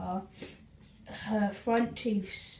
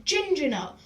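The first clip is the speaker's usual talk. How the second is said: usual speaking voice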